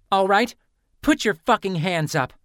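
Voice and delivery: loud, high demand to raise hands; shouted command, hands up. Acted. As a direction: bark the order at her. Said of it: "Lynn demanded".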